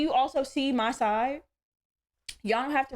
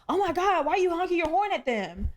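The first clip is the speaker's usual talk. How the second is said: higher-pitched imitation of a scandalized friend; mock dismay; 5 semitones higher than usual. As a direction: mimic the friend's high-pitched protest. Retells friend scolding her honking.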